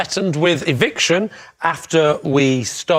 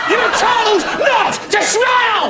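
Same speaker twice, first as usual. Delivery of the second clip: high, slow laughing shout; slower than usual. Real, not acted. Laughing shout at a contestant who can't keep a straight face. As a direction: yell it through laughter.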